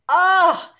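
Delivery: high, loud reenacted "ah"; retold exclamation. Retelling her own reaction.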